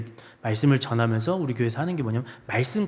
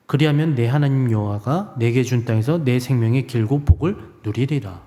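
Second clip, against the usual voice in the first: slow, louder, weighty recitation of the verse; solemn scripture reading; 2 semitones lower, 5 dB louder than usual. Acted. Preacher reading the drawn verse to the congregation, slow and solemn. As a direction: proclaim each phrase slowly as holy words. Reads scripture card aloud.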